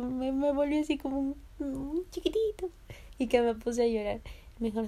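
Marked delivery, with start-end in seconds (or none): 0.0-2.7 high, small voice rising on "chiquitito"; tiny little thing. Retold or imitated. Sensitive girl shrinking before tough guards, high tiny voice. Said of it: "como que un osito chiquitito".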